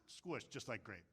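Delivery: low, soft imitation of a Japanese-accented mentor; Mister Miyagi. Mister Miyagi warning Daniel, hushed imitated Japanese accent. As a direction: drop low and quiet for the punch line, accented. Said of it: "and he says".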